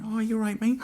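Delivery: high-pitched acted greeting to dogs; nervous friendly greeting. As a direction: pipe up high, placating the dogs. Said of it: "And I was going".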